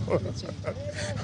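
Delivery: quiet, breathy laugh; short helpless laugh under the breath. Real, not acted. Resident laughing off government neglect to a reporter, quiet laugh. Bitter joke about starving.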